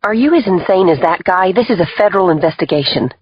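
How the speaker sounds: heated, high-pitched rebuke; indignant outburst. Acted. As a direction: confront the lawyer in disbelief. Agent berates the father's lawyer.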